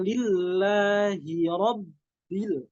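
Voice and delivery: "Lillahi Rabbil" with pressure on the doubled l and b, slow; stressed shadda. Shown as a demonstration. Teacher demonstrating stressed doubled letters, slow. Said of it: "ada huruf tasydid juga".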